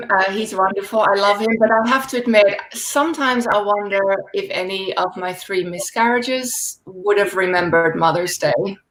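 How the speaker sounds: usual speaking voice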